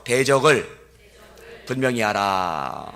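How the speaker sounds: 대적을 분명히 하라 said slowly and firmly for repeating; slow, firm line for the crowd to echo; slow call-and-response. Shown as a demonstration. Pastor leading the congregation to repeat, slow and firm. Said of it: "따라해 보세요".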